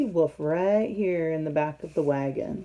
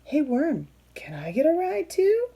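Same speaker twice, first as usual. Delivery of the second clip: much higher, eager cartoon whale; 9 semitones higher than usual. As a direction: ask Worm brightly for a turn. Whale asks for ride.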